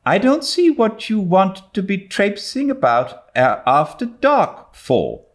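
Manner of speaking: lightly voiced stern older woman, short; a touch of a brisk, disapproving guardian; clipped older woman, mildly put-on; curt Marilla. A narrator's reading. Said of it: "said Marilla shortly".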